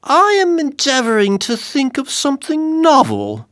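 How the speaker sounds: high, wandering dwarf voice; half-lost in thought; dazed dwarf answering the king; abstracted. A narrator's reading. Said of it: "replied the dwarf abstractedly".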